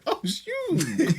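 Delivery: high-pitched comic alarm; mock dismay. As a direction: squeal it with a laugh behind it. Acting out waking in purgatory.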